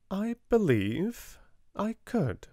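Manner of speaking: put-on thoughtful girl. A narrator's reading. Sarah, thoughtful, voiced.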